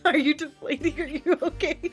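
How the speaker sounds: usual speaking voice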